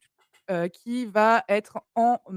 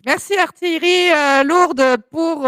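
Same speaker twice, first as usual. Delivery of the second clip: raised, loud, close-up voice; right on the mic; 5 semitones higher, 6 dB louder than usual. Real, not acted. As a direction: speak up with your nose on the microphone. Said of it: "j'ai mon nez, euh, sur mon micro".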